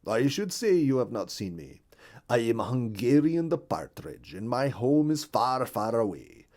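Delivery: lightly voiced foreign bird newcomer; a hint of a stranger's voice, emphatic at first, then settling; Hungarian the Partridge. Newcomer partridge introducing himself to a bluebird, lightly voiced.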